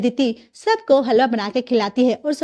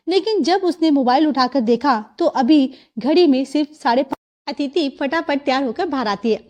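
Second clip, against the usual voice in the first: plain reading voice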